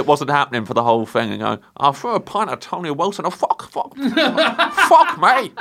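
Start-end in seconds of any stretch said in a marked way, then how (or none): 1.8-5.5 put-on Manchester accent, talking round chewing gum; Manc accent. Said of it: "Is that a Manc accent you're doing?".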